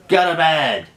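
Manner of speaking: high-pitched dismissive command; retold angry order. Retold row at home.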